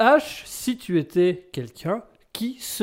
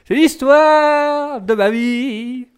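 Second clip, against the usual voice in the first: drawn out very slow, high and loud; mock tragic lament; 9 semitones higher, 10 dB louder, much slower than usual. Moaning about the complaints to the co-host, slow, high and loud. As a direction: ham up the woe of your whole life.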